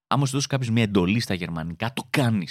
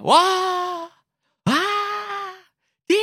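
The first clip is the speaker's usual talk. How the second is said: dramatic gasp. Pretend. Reacting to news as tragedy.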